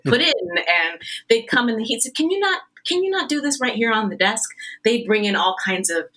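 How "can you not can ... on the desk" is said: high-pitched imitation of an exasperated request; mock pleading objection. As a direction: start high, then ease down while objecting.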